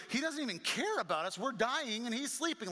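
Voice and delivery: high-pitched, aggrieved complaint; acted panic. Disciples dying while Jesus sleeps, acted panicky complaint. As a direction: protest in mock panic. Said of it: "the thought process went from".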